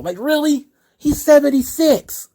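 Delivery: incredulous. Real, not acted. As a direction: scoff in disbelief at the rumor. Scoffing at internet rumors.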